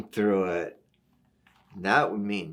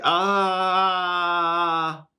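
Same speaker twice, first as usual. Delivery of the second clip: long sustained storybook cry, raised pitch; drawn-out "ah"; 5 semitones higher than usual. A narrator's reading. Reader sounds out an A with many H's, drawn-out cry. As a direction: stretch the "aah" like a tumbling cry. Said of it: "looks like about eight H's after it".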